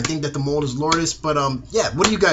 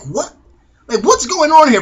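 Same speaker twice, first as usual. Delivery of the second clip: loud, high "What? what's going on here"; louder, higher startled reaction; shocked disbelief; 10 semitones higher, 6 dB louder than usual. Retold or imitated. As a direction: react as if you can't believe it. Baby smiling at alien ships.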